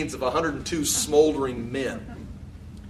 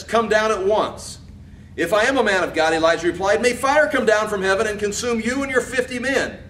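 high, loud proclamation; emphatic scripture reading; 7 semitones higher, 4 dB louder than usual. Acted. Second captain orders Elijah down and he answers, emphatic reading. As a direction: declaim the command and the fiery reply.

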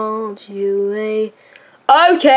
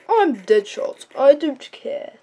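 usual speaking voice

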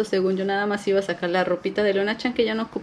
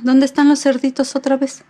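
playful question; 6 semitones higher, 5 dB louder than usual. Acted. Asking baby during book.

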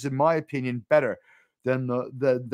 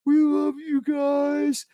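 goofy impression; 12 semitones higher, much slower than usual. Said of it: "It's like".

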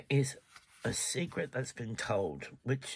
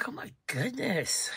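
surprised whisper; 10 semitones higher than usual. Real, not acted. Reacting to drawn card.

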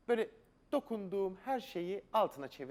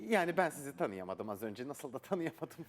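ordinary delivery

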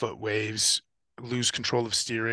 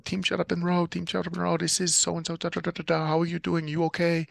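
higher-pitched imitation of a radio check-in; high, even radio-call voice; Spanish team radio call; 7 semitones higher than usual. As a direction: voice the other crew calling over the radio, pitched up. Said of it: "And then the radio comes off, you know, in the Spanish".

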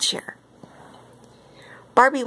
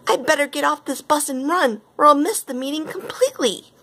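high-pitched, urgent resolve; worried storybook heroine in a rush; mild panic; 9 semitones higher than usual. A narrator's reading. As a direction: fret it in a raised voice. Barbie panics about missing meeting.

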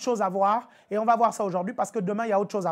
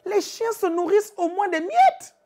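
pleading woman; 6 semitones higher than usual. Acted. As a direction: beg for the crumbs.

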